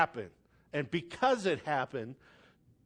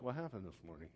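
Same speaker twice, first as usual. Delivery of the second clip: puzzled listener; 10 semitones lower, 16 dB quieter, much faster than usual. Retold or imitated. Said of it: "You're like".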